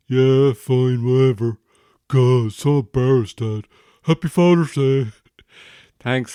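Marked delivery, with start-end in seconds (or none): 0.1-5.1 much louder, higher put-on teen voice; teen son grudgingly wishing his dad well; embarrassed teen son. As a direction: groan it out like a mortified teenager. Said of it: "Why are you talking like that?".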